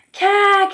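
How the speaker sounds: high, startled gasping cry; shocked gasp. Acted. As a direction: gasp out a sudden shriek of shock. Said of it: "he gasped".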